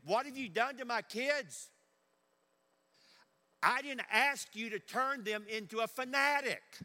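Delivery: high, loud mock outrage from a parent; indignant parent. Upset parent blames the campus pastor, loud, high mock outrage. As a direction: hurl the accusation like an upset parent. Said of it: "I've had parents say to me".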